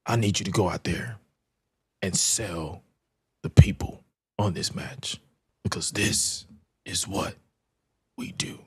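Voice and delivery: lightly voiced Triple H, sinking to a whisper; Triple H impression. Host pulling Oba aside, a quiet hint of Triple H. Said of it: "the Triple H impression".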